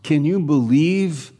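usual speaking voice

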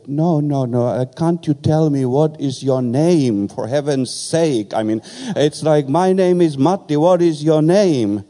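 exasperated customer begging the barber for his name; exasperated plea. Retold or imitated.

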